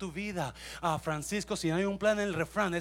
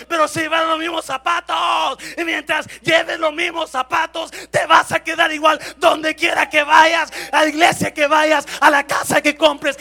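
high, shouted exhortation; full-force preaching; 12 semitones higher, 19 dB louder than usual. Real, not acted. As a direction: drive the warning home to the whole church. (exhortación a gritos, aguda y potente)

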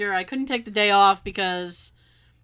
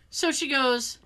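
usual speaking voice

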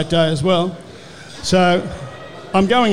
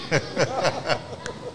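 soft laugh; 2 semitones lower, 10 dB quieter than usual. Real, not acted. Laughing at his own story.

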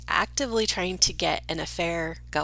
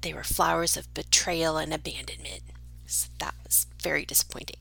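mock tragic whisper; 3 semitones lower, 3 dB quieter than usual. Mock dramatic disappointment.

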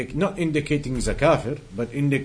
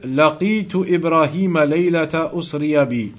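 rapid, practised Arabic recitation; hadith recitation; 4 semitones higher, 4 dB louder, much faster than usual. Acted. Teacher reciting the Prophet's words to students, fast Arabic recitation. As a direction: rattle off the memorised text.

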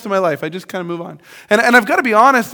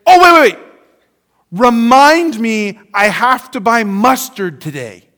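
sudden interruption; 4 dB louder, slower than usual. Retold or imitated. Friend suddenly breaks off their story, mock urgent. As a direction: imitate a friend blurting out a mustard reminder. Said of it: "and they say".

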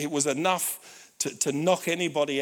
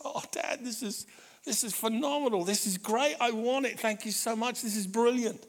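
awestruck son; 7 semitones higher than usual. Retold or imitated. Dad imitating son thanking him for the guitar, awestruck gushing. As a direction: gush gratefully in a raised pitch.

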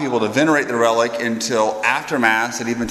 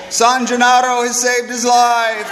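crowd cheering; 9 semitones higher than usual. Retold or imitated. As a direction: hail the saint like a cheering crowd. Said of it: "Everybody just starts screaming".

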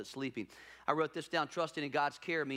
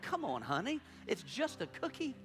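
laughing plea; 7 semitones higher than usual. Retold or imitated. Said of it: "I'm like".

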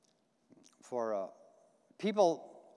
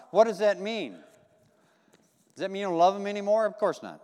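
normal talking voice

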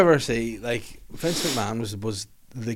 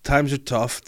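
ordinary delivery

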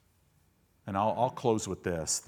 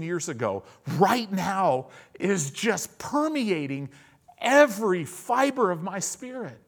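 loud, high, emphatic pulpit delivery; fired-up preaching; 10 semitones higher, 6 dB louder than usual. Real, not acted. Preacher on how you overcome, fired-up preaching. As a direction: drive it home with big stressed key words.

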